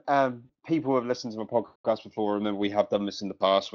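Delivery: ordinary talk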